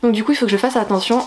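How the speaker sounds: normal talking voice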